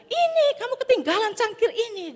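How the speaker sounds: high, bright hand-over; kindly offer. Acted. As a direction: offer the cup cheerfully. Pastor hands thief the cup.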